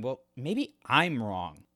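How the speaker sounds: usual speaking voice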